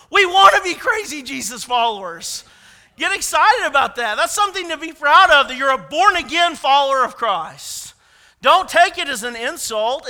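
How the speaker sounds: loud rousing sermon call; rally them, loud and proud; fervent preaching. Real, not acted. Preacher urging congregation, loud and rousing.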